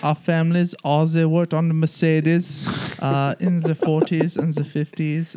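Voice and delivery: put-on hammy German accent; mock German accent. Mocking German Mercedes workers.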